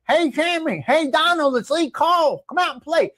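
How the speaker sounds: high, mock-menacing callout; Lee Cole voice. Lee Cole taunting Trump to come out and play, high put-on voice. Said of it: "I'm gonna send Lee Cole to".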